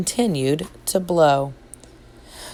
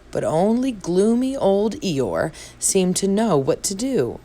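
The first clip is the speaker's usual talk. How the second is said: plain reading voice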